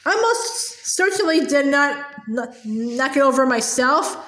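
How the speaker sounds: plain reading voice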